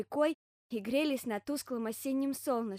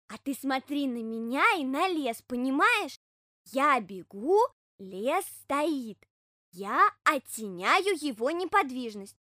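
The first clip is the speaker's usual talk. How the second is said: louder, slow hedgehog voice, leaning on key words; earnest hedgehog explaining; 3 semitones higher, 6 dB louder, slower than usual. Acted. Hedgehog instructing bear cub how to watch him, slow and emphatic.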